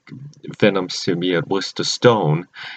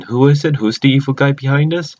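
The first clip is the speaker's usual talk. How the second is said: higher, eager build-up to a reveal; suspenseful teasing question; 5 semitones higher, faster than usual. Real, not acted. Vlogger to viewers before revealing the villain, eager suspense. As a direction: tease the answer, pitch lifted.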